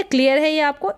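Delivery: ordinary talk, nothing marked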